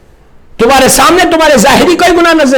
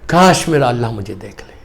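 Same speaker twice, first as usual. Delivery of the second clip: very low, soft, imagined prayer; quiet anguished longing; 7 dB quieter than usual. Retold or imitated. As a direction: murmur the imam's wish with restrained yearning. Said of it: "تو امام نے تڑپ کے کہا". (دھیمی آواز میں تڑپتی آرزو)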